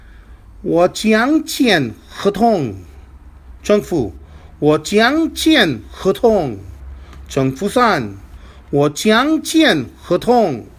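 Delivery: 我将签合同 said slowly and carefully, phrase by phrase; slow model sentence. Shown as a demonstration. Teacher reads example sentence slowly to the class. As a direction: read 我将签合同 slowly for learners to repeat. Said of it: "Our first sentence is".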